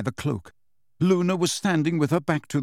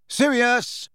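shout; 10 semitones higher than usual. Acted. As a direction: shout the name into the empty room. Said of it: "he shouted".

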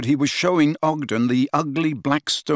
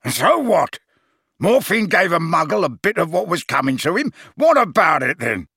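raised, combative challenge; angry retort; 5 semitones higher, 4 dB louder than usual. A narrator's reading. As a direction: throw it back at him, higher and heated. Gaunt angrily defends his son.

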